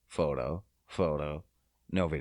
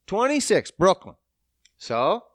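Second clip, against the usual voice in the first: normal talking voice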